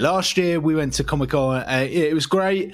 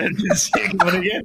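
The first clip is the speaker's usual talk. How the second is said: laughing; 6 semitones higher, faster than usual. Real, not acted. Laughing mock sob.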